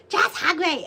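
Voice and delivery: high, loud bossy yell; loud angry demand. Retold or imitated. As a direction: yell it like a cranky little thing. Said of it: "like, so mad".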